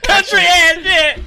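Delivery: high, loud and slow, dragged out; mock angry shout. Retelling the bug-in-mouth guy's rant, slow high loud yell. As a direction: yell it out like a man fed up with his town. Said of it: "He's like".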